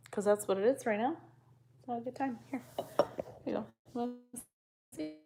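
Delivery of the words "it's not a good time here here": usual speaking voice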